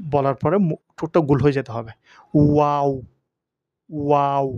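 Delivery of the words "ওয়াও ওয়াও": ওয়াও with lips rounded; ওয়াও, ঠোঁট গোল করে; Arabic letter waw. Shown as a demonstration. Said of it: "এভাবে বলতে হবে".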